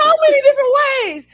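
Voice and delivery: fast, high-pitched outburst; loud exclamation. Real, not acted. Agreeing loudly with the co-host, high excited outburst.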